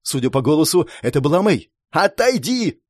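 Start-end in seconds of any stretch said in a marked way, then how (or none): 1.9-2.8 high, urgent warning cry; shouted warning. A narrator's reading. Girl warns her ally mid-fight, high shouted command. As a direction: cry out to get someone clear. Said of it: "крикнула она".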